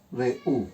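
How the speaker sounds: "Re, u" as two low, soft, separate beats; split into syllables. Shown as a demonstration. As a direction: pause between "Re" and "u". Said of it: "se separan".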